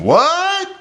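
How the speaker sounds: very high, loud, shrieked what; upset question. Shown as a demonstration. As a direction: ask it loudly, outraged, pitch shooting up. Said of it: "You're upset and you're asking a question".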